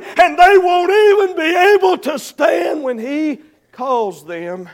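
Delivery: loud, high sermon delivery; full-force preaching. Real, not acted. Preacher warning the church of coming judgment, full-force preaching.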